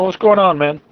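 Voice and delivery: normal talking voice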